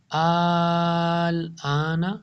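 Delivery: آلانا slowly with the opening آ long; Quran recitation. Shown as a demonstration. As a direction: draw out آلانا. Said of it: "یا تو اس کو الانا پڑھ دیتے ہیں". (آلانا لمبے الف کے ساتھ)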